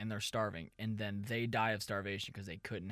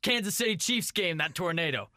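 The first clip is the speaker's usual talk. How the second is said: higher, louder mock-angry police captain; hammed-up boss telling his cop to let it go; 10 semitones higher, 8 dB louder than usual. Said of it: "I'm the captain".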